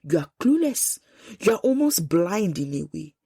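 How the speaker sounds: normal talking voice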